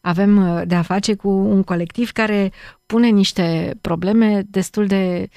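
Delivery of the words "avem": ordinary talk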